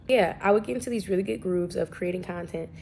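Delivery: usual speaking voice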